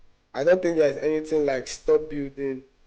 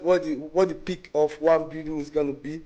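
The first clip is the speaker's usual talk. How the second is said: ordinary talk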